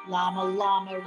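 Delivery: usual speaking voice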